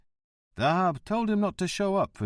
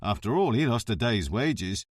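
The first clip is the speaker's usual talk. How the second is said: ordinary delivery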